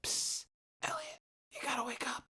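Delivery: urgent whisper to wake someone; calling out softly. Retold or imitated. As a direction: whisper "psst" to rouse a sleeper. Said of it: "I heard Weatherwood calling out to me".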